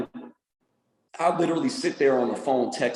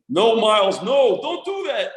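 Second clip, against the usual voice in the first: dad's voice; 7 semitones higher than usual. Retold or imitated. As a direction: pitch up and drag it out like grandpa. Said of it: "he'll say".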